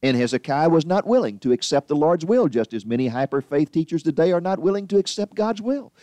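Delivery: normal talking voice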